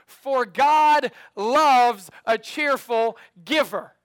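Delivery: loud, slow call-and-response line; slow unison recitation. Shown as a demonstration. Pastor reciting the verse slowly with the church. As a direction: draw out every word with the congregation. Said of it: "say this part with me".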